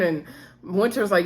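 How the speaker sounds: ordinary talk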